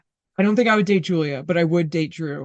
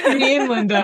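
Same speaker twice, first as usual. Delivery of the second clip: laughing; 5 semitones higher, 4 dB louder than usual. Real, not acted. Laughing at the tease.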